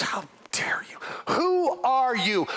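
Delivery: harsh, whispered sneer of a rich man; scornful whisper. Acted. Preacher voicing Nabal scorning the messengers, a whispered sneer. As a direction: hiss it out with contempt.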